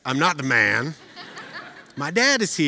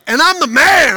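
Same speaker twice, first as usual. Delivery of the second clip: much higher, loud boast; proud shout; 12 semitones higher, 15 dB louder than usual. Retold or imitated. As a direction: crow it out proudly. Son voiced shouting proudly.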